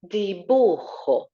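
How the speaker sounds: dibujo said slowly with a rasped throat j; throaty j, slow. Shown as a demonstration. Teacher slowly repeating dibujo, throaty j. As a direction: stretch out dibujo, kh at the back of the throat. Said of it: "Nice he sound at the back of our throat".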